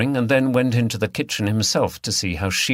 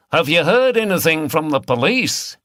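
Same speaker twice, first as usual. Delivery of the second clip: raised, higher voice across the bar; calling out; 7 semitones higher, 4 dB louder than usual. Acted. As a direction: keep calling over to the barman. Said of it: "he called out".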